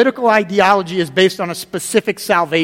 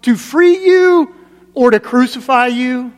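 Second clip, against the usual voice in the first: higher, louder, slow and looming threat; frustrated Pilate; 6 semitones higher, 6 dB louder, slower than usual. Retold or imitated. Preacher voices Pilate threatening Jesus, frustrated and loud. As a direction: lean on "to free you" like a ruler flexing power. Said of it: "he's getting frustrated".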